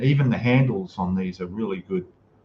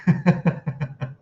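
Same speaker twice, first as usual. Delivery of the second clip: amused laugh; 5 semitones higher than usual. Real, not acted. Reacting to dragon joke.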